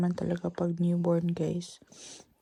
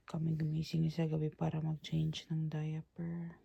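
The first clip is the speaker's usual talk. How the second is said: hushed whisper to the camera; 10 dB quieter than usual. Real, not acted. Whispers beside sleeping baby.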